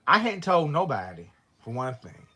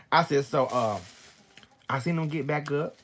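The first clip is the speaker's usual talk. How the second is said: usual speaking voice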